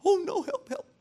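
high-pitched feigned panic; mock fretting. Preacher shows how Jesus wasn't on the cross, mock panicked. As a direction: squeal a hammed-up plea for help. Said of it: "He wasn't fretting up there".

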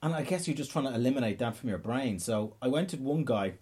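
ordinary talk, nothing marked

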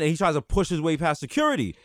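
ordinary delivery

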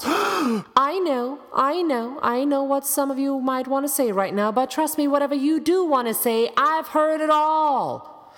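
high, insistent cutting-off of objections; exasperated. Acted. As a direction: hold off the listeners, pitched high throughout. Exasperated address to anticipated objections.

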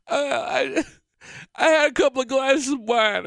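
high, teary mock voice of his tipsy wife; imitating tipsy wife. Husband mimicking his tipsy wife's confession. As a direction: whine it up high like she's had wine.

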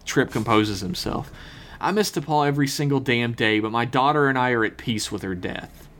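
normal talking voice

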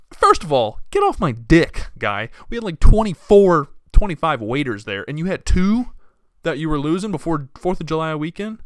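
loud, heated rant at the boss; angry rant. Retold or imitated. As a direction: vent it hard, rising pitch. Ranting at the absent manager.

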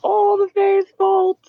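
rising into a high, slow rant; screaming. Acted. [name] in the fight, high rising mock scream. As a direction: scream curses at the Fae. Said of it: "I'm standing there screaming".

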